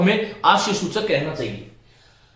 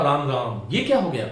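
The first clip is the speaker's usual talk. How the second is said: Ram Ram sighed low and soft; quiet lament; soft dismayed exclamation; 8 semitones lower, 5 dB quieter than usual. Shown as a demonstration. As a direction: murmur in shocked dismay. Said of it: "लेकिन हमने कहा".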